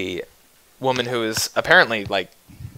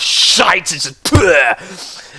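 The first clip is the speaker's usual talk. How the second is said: mock spitting disdain; spat-out contempt; 4 semitones higher, much slower than usual. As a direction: spit the word out in disgust. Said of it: "And he spits out".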